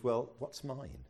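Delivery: normal talking voice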